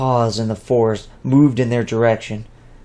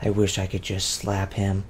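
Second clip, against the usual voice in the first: lower, much softer aside to herself; inner thought; 4 semitones lower, 9 dB quieter than usual. Acted. Two privately grumbles about her brother, much softer. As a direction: keep it under the breath, just for her.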